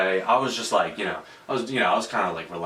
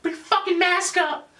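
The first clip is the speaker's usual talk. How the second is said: squeaky falsetto yell, much higher; mocking high voice. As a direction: imitate his shrill high-pitched demand in a falsetto. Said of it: "this really high voice".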